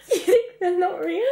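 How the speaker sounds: high, laughing incredulity; laughing disbelief. Real, not acted.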